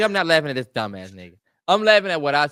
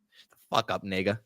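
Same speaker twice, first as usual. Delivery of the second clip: low, softer grumbled brush-off; muttered retort; 10 semitones lower, 5 dB quieter than usual. Retold or imitated. Player brushing off the coach's son, muttered retort.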